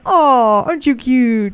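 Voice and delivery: put-on high cooing baby talk. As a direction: coo it to a newborn.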